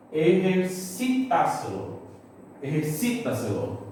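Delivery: "Ejercitáculo" as Spanish "eh-her-SI-ta-se-lo"; stress on fourth-last syllable. Shown as a demonstration. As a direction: sound out "Ejercitáculo" with the beat on "SI". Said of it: "ante-antepenúltima".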